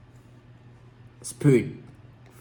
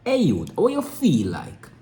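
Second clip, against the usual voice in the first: put-on Jamaican accent. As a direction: call out like a street youth. Mock Jamaican street talk.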